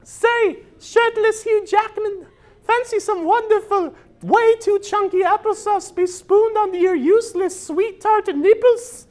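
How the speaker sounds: very high falsetto lady voice; Nicole Kidman. Acted. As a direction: squeak it out like a prim movie star. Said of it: "I will reenact the scene for you".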